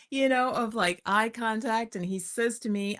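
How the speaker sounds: usual speaking voice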